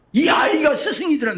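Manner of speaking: sudden loud high cry of realization; a moved exclamation; emphatic realization. Real, not acted. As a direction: let the truth burst out. Story climax, realization.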